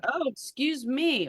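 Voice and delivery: slow, exaggerated pointed dig; passive aggressive. Parent taking offence at teen, mock passive aggressive. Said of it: "all that kind of passive aggressive".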